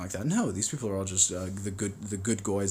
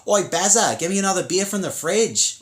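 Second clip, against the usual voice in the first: high, loud Aussie bogan shout; broad Australian accent; 10 semitones higher, 6 dB louder than usual. Shown as a demonstration. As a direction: call out to a mate in broad Aussie. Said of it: "they got accents like this".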